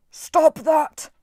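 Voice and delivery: high, loud otherworldly order; demanding. A narrator's reading. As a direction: order him to stop, furious. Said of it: "Wolfhead demanded".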